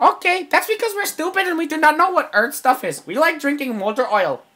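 high, monotone alien explaining; Conehead. Acted. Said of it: "I'm gonna do my Conehead impression".

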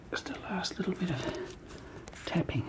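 soft unvoiced whisper while tapping. Real, not acted. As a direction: breathe the words to the cat.

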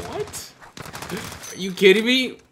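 very high, then loud outraged disbelief; are you serious right now; incredulous. Real, not acted. Gamer reacting to a stacked enemy team, incredulous.

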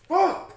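high, loud pained cry of fuck; anguished sighing curse. Real, not acted. Mourning his favorite show writer on camera. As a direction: let the grief burst out in a strained high yell.

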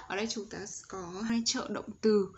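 normal talking voice

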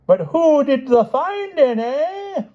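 loud, slow teasing boom; booming, arch. A narrator's reading. Stout lady teases the king, booming archly. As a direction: tease him in a big hearty lady's voice. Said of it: "booming voice, archly tapping his cheek".

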